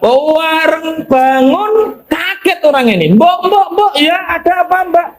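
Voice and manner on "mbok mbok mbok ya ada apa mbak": alarmed calling. Acted. Startled man calling the madam.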